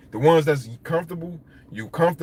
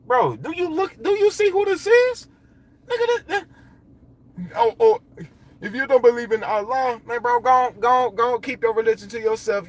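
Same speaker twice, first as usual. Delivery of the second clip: defiant retort; 9 semitones higher than usual. Retold or imitated. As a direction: stand up to the guard, incredulous. Voicing Osei defying guards.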